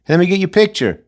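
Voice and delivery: mock photographer. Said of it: "That's how you get your picture taken".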